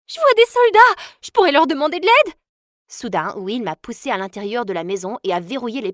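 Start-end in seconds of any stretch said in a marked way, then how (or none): 0.1-2.3 high, loud and eager; a sudden spark of hope at seeing soldiers; bursting out with a high, loud rescue idea; excited hope. Acted. Runaway girl spots soldiers.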